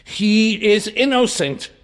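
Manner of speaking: insistent plea. Acted. Morel pleading for Dantes.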